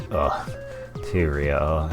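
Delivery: ordinary talk, nothing marked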